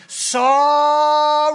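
loud, high, drawn-out whiny sorry; a grudging teenage sorry; exaggerated begrudging sorry; sarcastic sorry. Shown as a demonstration. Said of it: "Not that".

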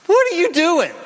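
high, loud sneering challenge; mocking enemy. Enemy mocking a believer, high sneering taunt. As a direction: jeer at him like the enemy. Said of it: "the stinging mock of the enemy".

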